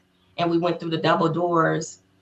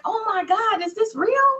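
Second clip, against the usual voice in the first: starstruck disbelief. Retold or imitated. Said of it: "we were like".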